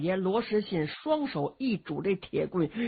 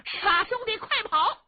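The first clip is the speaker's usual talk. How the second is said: much higher, loud yell to run; high urgent shout; 12 semitones higher, 3 dB louder than usual. A narrator's reading. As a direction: shout back over the shoulder. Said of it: "回头嚷".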